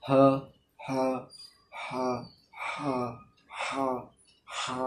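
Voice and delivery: হা with throat pressed inward. Shown as a demonstration. Teacher drilling the pressed throat ha. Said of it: "চাপ দেবেন যখন হা বলার সময়".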